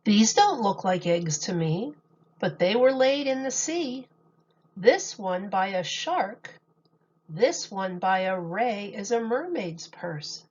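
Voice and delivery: gentle sing-song picture book reading; storybook voice for kids; reading to children. Acted. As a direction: read the rhymes to a little audience. Reads picture book aloud to children.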